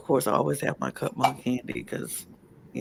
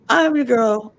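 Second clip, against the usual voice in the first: excited; 8 semitones higher, 8 dB louder than usual. Real, not acted. Hyped self-introduction.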